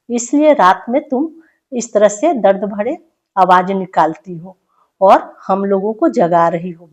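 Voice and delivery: ordinary talk, nothing marked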